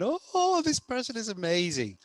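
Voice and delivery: high squealing rave; gushing fan. Retold or imitated. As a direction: gush like an adoring fan. Imitated fans gushing over podcast.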